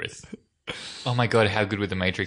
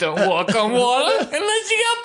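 much higher, loud mock bully voice; Biff quote; 5 dB louder than usual. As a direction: sneer it like a movie bully. Quoting Biff's movie line.

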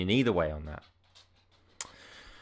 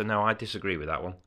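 ordinary talk, nothing marked

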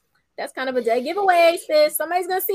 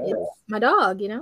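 concerned Sammy; 5 semitones lower than usual. Retold or imitated. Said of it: "He always sounds so concerned".

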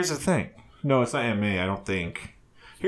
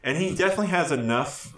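normal talking voice

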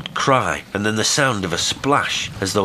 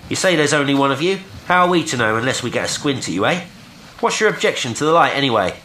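rapid, high, raised-voice tirade; brisk challenge; 8 semitones higher, 4 dB louder, faster than usual. A narrator's reading. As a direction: rattle the questions off across the water. Will challenges stranger across water.

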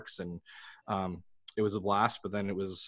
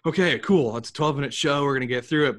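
louder, higher, no-nonsense hustle; briskly, like a busy New Yorker; brisk East Coaster; 5 semitones higher, 6 dB louder, faster than usual. Retold or imitated. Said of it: "that East Coast mentality of".

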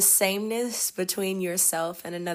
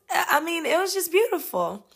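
usual speaking voice

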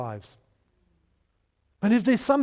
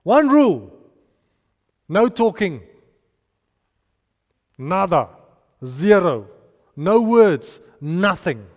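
Joshua commanding; 6 dB louder than usual. Retold or imitated. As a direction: lay down the one rule to the people. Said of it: "he went to the people and said".

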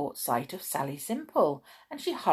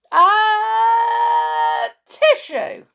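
long drawn-out shouted sneeze, high and loud; hammed-up sneeze for the kids; huge sneeze; 11 dB louder, much slower than usual. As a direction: let a giant sneeze burst out. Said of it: "And when it did come".

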